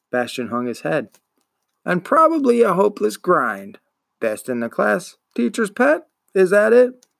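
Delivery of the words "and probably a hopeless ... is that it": higher and louder, mocking tease; mock teasing. A narrator's reading. Shopkeeper mockingly asks if the boy is teacher's pet. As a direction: rise into a sneering question.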